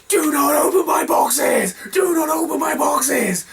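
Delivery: slow, high-pitched scolding yell in imitation; woman's angry shriek. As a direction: mimic an outraged woman, high and loud. Retold woman screaming at box opener.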